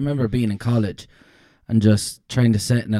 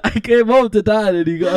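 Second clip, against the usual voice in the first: ordinary delivery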